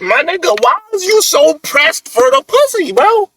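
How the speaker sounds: high, incredulous mock call-out; mocking disbelief. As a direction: throw it at him like you can't believe him. Mocking TJ for being pressed.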